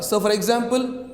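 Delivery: ordinary talk, nothing marked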